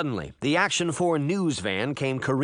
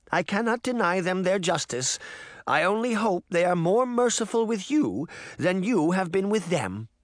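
solemn grandmother; 6 semitones higher than usual. A narrator's reading. Grandmother refuses Jack, solemnly.

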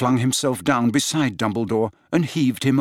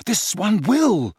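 gasped; 9 semitones higher, slower than usual. A narrator's reading. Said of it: "gasped Harry".

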